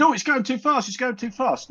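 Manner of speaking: high, mock-flustered imitation of Brian. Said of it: "he said".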